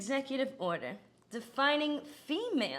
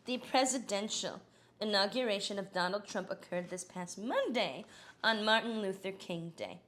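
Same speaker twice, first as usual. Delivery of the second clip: ordinary delivery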